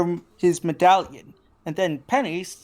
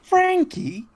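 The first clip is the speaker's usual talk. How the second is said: a doting woman recognising a name; Penny's voice. Acted. Penny voice continues.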